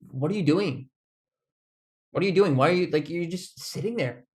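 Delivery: exasperated mock question. Voicing the play-last attitude.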